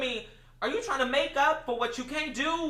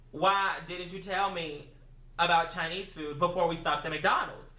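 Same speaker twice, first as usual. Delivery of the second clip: retold annoyed reply; 7 semitones lower than usual. Eating fries, reproaches roommate for late suggestion, low and annoyed. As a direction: drag out a lower, deadpan complaint.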